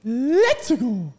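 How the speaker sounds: high, loud cheerful Mario. Acted. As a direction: whoop it out like Mario. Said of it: "I can do an okay Mario one".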